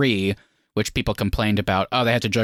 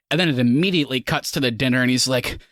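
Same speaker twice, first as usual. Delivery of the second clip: normal talking voice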